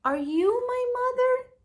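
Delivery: put-on high, small baby bird voice, asking; a lost little bird asks each animal. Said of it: "he asked the hen".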